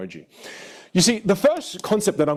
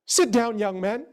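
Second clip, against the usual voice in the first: higher-pitched teacher's order, lightly acted; mock teacher instruction, pitched up; lightly voiced music teacher; 6 semitones higher, slower than usual. Music teacher to new student at the piano, lightly mimicked. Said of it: "she just says".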